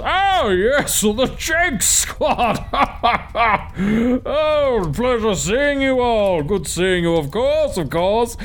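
a big red-bearded host laughing over his turkey leg; boisterous host. Acted. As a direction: greet old friends like a feasting lord. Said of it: "very boisterous, somewhat overweight man with a red beard".

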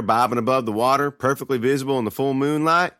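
usual speaking voice

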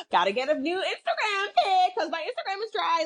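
high-pitched, hammed-up whine; mock Instagram-obsessed traveller. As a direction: squeal it like a desperate social media poser. Mocks volunteer wanting Instagram photos.

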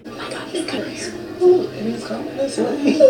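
breathless, high excited whisper. Acted. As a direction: gush in a hushed thrill. Excited girls spotting man.

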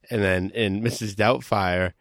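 ordinary talk

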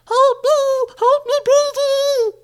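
very high, loud squeaky cry for help; tiny shouted plea. Acted. Tiny captain calling his friend for help, squeaky shout. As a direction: squeal for rescue like a shrunken little man. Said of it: "he shouted".

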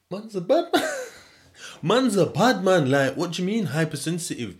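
loud, high mock indignation; indignant disbelief. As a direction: protest loudly, pitched right up, as if insulted. Acts out indignant reply to hypersensitive.

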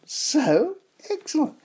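excited. Real, not acted. Presenter gushing over the socks, excited echo. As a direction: swoon over it.

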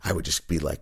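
ordinary talk, nothing marked

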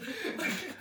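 squeaky burst of laughter; high laugh. Real, not acted. Laughing after bit.

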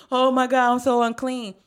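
exaggerated mock alarm; mock panic. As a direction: cry out like a guilty, panicked believer. Imitating anxious believer's panic.

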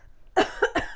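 sudden choking cough; coughing fit. Real, not acted. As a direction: cough from tea down the wrong way. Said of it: "I just drank my tea and went the wrong way".

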